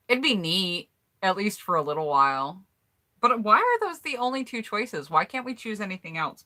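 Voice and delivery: ordinary talk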